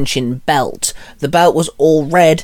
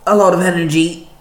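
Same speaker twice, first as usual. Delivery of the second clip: wincing; 4 semitones higher than usual. A narrator's reading. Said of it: "Penny winced".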